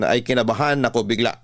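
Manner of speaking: ordinary talk, nothing marked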